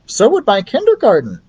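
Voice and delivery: agreeing teacher. Acted. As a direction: chime in brightly. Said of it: "agreed Betty".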